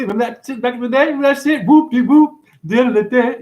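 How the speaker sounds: high, loud, rushed burst; high-pitched excited chanting; excited recollection. Real, not acted. Excitedly recalling an old tune.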